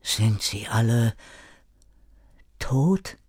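hesitant question rising to a whispery end; trembling, quiet. A narrator's reading. Erik asking René about the others, quiet and fearful. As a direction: ask it quietly, dreading the answer. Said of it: "Zitternd fragte er leise".

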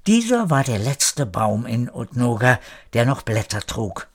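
usual speaking voice

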